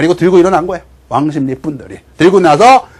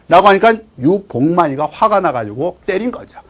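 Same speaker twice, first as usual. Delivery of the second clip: plain reading voice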